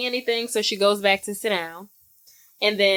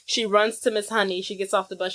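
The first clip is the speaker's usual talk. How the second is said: ordinary delivery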